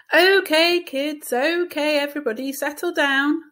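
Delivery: loud, high teacher voice calling the room to order. A narrator's reading. Teacher calls a chattering class to order, loud and high. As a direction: raise your voice over a noisy class.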